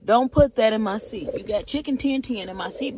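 ordinary talk, nothing marked

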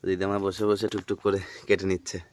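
ordinary talk

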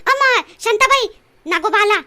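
loud scared shout; frightened cry. Acted. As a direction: yell in fright at the cobra. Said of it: "बोंबलू नको".

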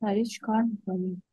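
ordinary talk, nothing marked

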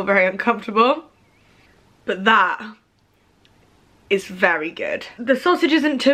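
muffled behind fingers; speaking after a bite; hand over mouth while talking. Real, not acted. Seen 3: Hand covers mouth from 2.3s; chewing not clearly visible.

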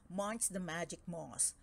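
usual speaking voice

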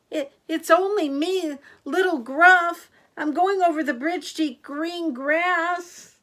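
high, loud little-goat voice; timid little goat. A narrator's reading. Little Gruff answers the troll, high small voice.

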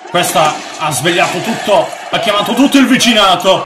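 loud complaint climbing high and fast; agitated complaint. Real, not acted. Player dismayed the whole neighbourhood was alerted, agitated.

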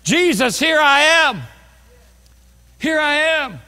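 high, loud pleading prayer; urgent, loud plea to be heard; crying out. Pretend. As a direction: call out to Jesus with everything. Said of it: "stand up and say".

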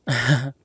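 short loud burst of laughter. Acted. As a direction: let out one quick amused laugh. Amused by partner in roleplay.

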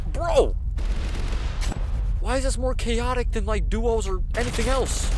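high, loud, frantic protest; exasperated yelling. Real, not acted. Gamer swamped in a solo match, frantic exasperated yell. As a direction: yell in disbelief at the chaos. Overwhelmed by chaos in firefight.

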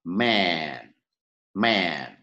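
"Man" with a long, wide-lipped vowel, falling. Shown as a demonstration. Teacher has learners repeat after them, long wide vowel. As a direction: stretch the open vowel in "Man". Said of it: "đọc từ cột thứ nhất".

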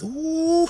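high-pitched admiring ooh; high "ooh" of delight. Real, not acted. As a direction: let out a high, drawn-out whoop. Reacting to the sauce.

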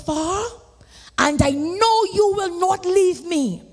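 full-force preaching. Acted. Preacher testifying to the congregation, full-force preaching. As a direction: preach it out to the saints.

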